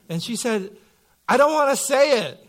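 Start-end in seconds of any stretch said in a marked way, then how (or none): 1.3-2.4 put-on reluctant little girl. Daughter voiced in retold story.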